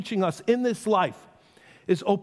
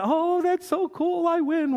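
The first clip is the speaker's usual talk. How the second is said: much higher, slow delighted squeal; mock parent thrilled to win; gleeful parent; 10 semitones higher than usual. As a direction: gloat like the winning parent. Parent winning first-word contest.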